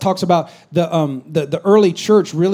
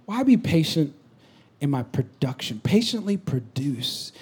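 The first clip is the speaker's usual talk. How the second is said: soft, hushed "why" to God; pleading with God; 8 dB quieter, slower than usual. Retold or imitated. Pastor retelling his hushed plea to God. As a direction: ask God quietly, almost under breath. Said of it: "I was like".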